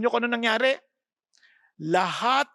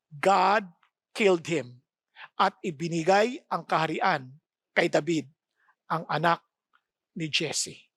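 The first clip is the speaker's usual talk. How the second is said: solemn scripture reading; 7 semitones lower than usual. Acted. Solemn scripture quote, slow.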